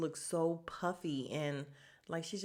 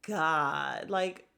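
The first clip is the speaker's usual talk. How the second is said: exasperated groan; 7 dB louder, much slower than usual. Retold or imitated. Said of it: "And I was just like".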